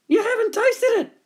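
high squeaky animal voice; insistent animals. A narrator's reading. Animals tricking Dingo into tasting, insistent.

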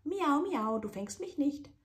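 high, loud taunting singsong; taunting kitten. Acted. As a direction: taunt the chef playfully.

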